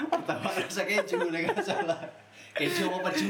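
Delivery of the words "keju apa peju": cracking up at his own dirty pun; laughing. Real, not acted. Joking crudely with his podcast buddy, laughing. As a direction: giggle the question out, high and loud. Cheeky joke to his friend.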